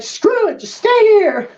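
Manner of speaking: high exasperated give-up; exasperated squeak. Acted. Tiefling giving up on the bird, high and fed up. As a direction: throw up your hands in a squeak. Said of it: "He's like".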